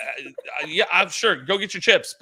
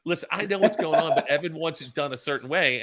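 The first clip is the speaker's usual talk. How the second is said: ordinary talk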